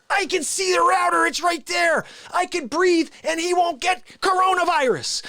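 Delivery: high-pitched comic exasperation; exasperated. Acted. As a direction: rant in a high, incredulous voice. Mock rant at his router.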